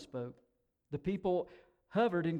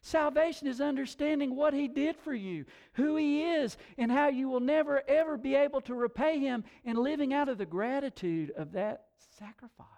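loud, high, emphatic preacher; forceful preaching; 10 semitones higher, 7 dB louder than usual. Real, not acted. Preacher correcting the congregation on salvation, forceful preaching. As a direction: drive each phrase home with raised voice.

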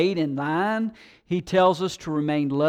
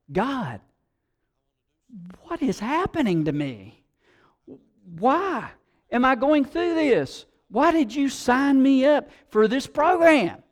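higher pleading cry to God; plea to God; 7 semitones higher than usual. Pretend. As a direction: voice our bewildered why. Imagined complaint to God.

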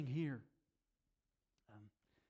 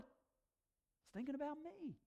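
high, slow, softened wonder; David; 12 semitones higher, slower than usual. Retold or imitated. Psalmist realizing God thinks about him, hushed wonder. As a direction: drop from the shout into slow amazement.

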